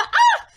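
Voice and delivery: high playful shriek; mother's playful mock scream. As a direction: shriek as if startled. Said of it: "she'd go the".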